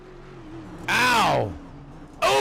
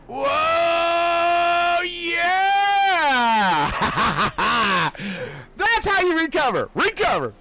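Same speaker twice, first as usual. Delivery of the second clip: real cheering, laughing between shouts; excited shouting; 10 semitones higher, much slower than usual. Real, not acted. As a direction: yell it out, thrilled. Wild save, commentator erupts.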